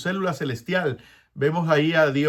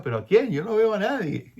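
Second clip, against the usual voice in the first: mock puzzled surprise; 7 semitones higher than usual. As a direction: act puzzled that nobody is there. Isaiah voiced looking around puzzled. (Isaías fingiendo sorpresa, más agudo y juguetón)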